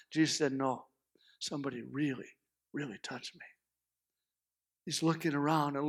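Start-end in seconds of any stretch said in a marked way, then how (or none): 0.5-3.5 low, hushed certainty; quiet insistence. Acted. Jesus answers the cranky disciples, quietly insistent. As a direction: drop right down and insist softly.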